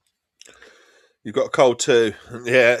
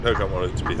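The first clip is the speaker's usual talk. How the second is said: normal talking voice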